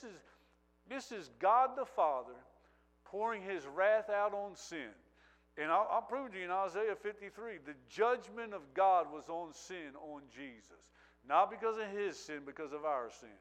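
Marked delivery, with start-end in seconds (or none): none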